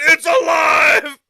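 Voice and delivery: slow drawn-out triumphant yell; imitating a crazed inventor; mock mad scientist shout. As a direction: bellow it like a movie mad scientist. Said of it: "He looked at the damn screen and said".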